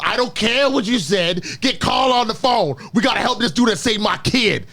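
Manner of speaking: hammy New Yorker demanding the phone; terrible New York accent. Retold or imitated. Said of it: "it's a terrible New York accent".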